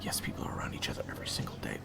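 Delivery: rapid hushed warning; fast urgent whisper. Acted. Waiter Zach warning his friend, fast urgent whisper. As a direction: rush it out under your breath.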